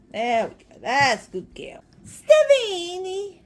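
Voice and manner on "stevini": slow, loud, lifted "Stevini"; drawn-out name. Real, not acted. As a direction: stretch the name out with pride. Presenting the cat.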